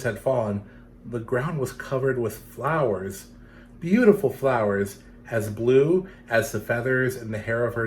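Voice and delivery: gentle storytelling read; story narration. A narrator's reading. Reading a legend to children, gentle storytelling.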